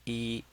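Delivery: Yi held level; flat first tone. Shown as a demonstration. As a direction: say "Yi" on one flat note. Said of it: "that's a flat tone".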